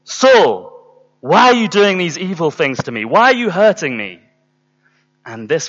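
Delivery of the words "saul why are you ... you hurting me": loud, commanding voice from above; booming divine reproach; voice from heaven. Retold or imitated. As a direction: call out forcefully, higher than usual, accusing him. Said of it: "there was this voice that came from heaven".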